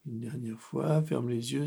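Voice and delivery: ordinary delivery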